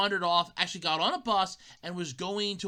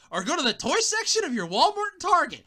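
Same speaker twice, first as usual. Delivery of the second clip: ordinary talk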